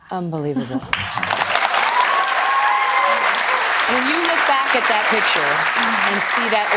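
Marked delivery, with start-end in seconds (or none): 0.9-3.9 high-pitched airy laugh; high breathy laugh. Real, not acted. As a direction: laugh out in delighted disbelief. Host reacts to weight loss.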